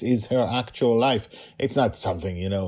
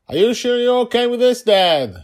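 put-on child voice; 10 dB louder than usual. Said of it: "he asked his daughter".

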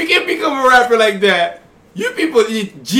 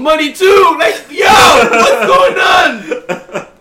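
higher, louder, drawn-out protest; indignant shouting; 6 semitones higher, 5 dB louder, much slower than usual. Real, not acted. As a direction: cry out in exasperated disbelief. Ranting about others making money.

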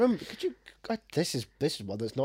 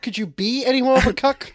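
high, loud sarcastic quip; hammed-up mocking question; mock sitcom catchphrase; 9 semitones higher, 6 dB louder than usual. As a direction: exaggerate BE like a sitcom wisecrack.

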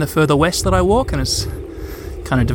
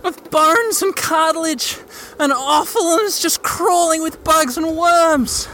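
horrified awe; 8 semitones higher, slower than usual. Real, not acted. Describing corpse in awe.

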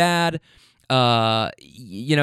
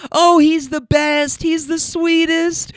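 high, loud, drawn-out mock gushing; hammed-up squeals of adoration; gushing delight; 12 semitones higher, 5 dB louder, slower than usual. Riders adoring the young driver, high mock gushing.

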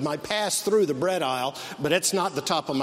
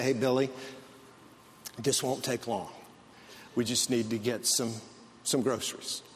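lower, softer soothing dad voice; calm dad; 5 semitones lower, 6 dB quieter than usual. Retold or imitated. Dad calmly reassuring his son Billy in the store. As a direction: soothe a fussy kid evenly. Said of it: "the dad was as calm as he could be".

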